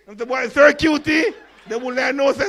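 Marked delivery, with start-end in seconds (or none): 0.5-1.3 loud, high call to the teacher. Retold or imitated. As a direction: shout "sir" up from the class. Student calling out.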